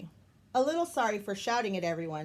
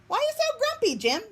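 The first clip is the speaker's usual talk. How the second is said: much higher, bright-eyed questioning voice; high Maribel voice; 5 dB louder, faster than usual. Acted. Maribel asks grumpy Jim, in a very high voice. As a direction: ask it way up high like a chirpy bird.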